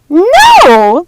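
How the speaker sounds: high, loud shrieked no; screams. A narrator's reading. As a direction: shriek in horror. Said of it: "The Odd Sisters' screams".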